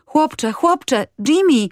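put-on high, urgent call after the boy; girl runs after boy, calling urgently. A narrator's reading. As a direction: call him back before he leaves. Girl calls after running boy.

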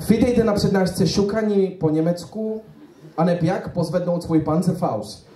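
mock German accent in Czech; German lecturer character. German lecturer addresses a Czech audience, mock German accent. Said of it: "vcítit do jedné postavy".

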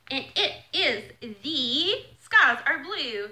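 slow, loud, word by word; deliberate, emphatic unveiling; grand item announcement. Real, not acted. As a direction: present each word like a prize. Announcing the jacket's name.

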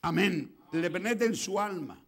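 loud, slow, emphatic preaching; drawn-out loud pulpit delivery; full-force preaching. Real, not acted. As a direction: proclaim it to the congregation with force.

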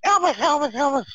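put-on very high girlish squeal; squealed, high-pitched mock scream; screaming fan. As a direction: imitate a frantic fan calling her idol. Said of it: "I'm screaming".

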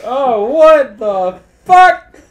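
high, loud, slow, laughing shock; shocked disbelief. Retold or imitated. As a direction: drag it out in hammed-up horror. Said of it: "And then he's like".

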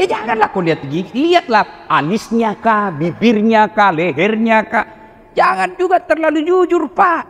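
ordinary delivery

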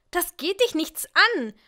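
loud, high angry shout. A narrator's reading. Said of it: "schrie ich noch ein bisschen lauter". (wütend geschrien)

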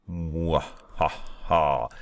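slow mock villain laugh; drawn-out mad scientist cackle; evil laugh. Announces the ultimate test.

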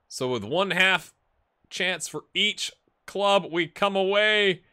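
high, slow, drawn-out commentary; live play-by-play. Acted. Streamer summing up a chance for each club, commentator voice. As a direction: stretch out each phrase like a match commentator.

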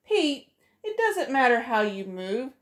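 plain reading voice

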